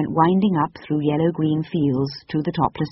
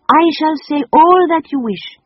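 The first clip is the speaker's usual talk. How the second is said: put-on high, loud French-accented gush; French lady; 12 semitones higher, 8 dB louder than usual. A narrator's reading. The lady talks over the children, high loud French accent. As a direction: insist warmly in a French accent.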